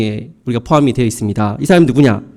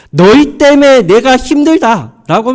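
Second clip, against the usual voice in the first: resentful father; 7 semitones higher, 4 dB louder than usual. Acted. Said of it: "막 원망을 하니까".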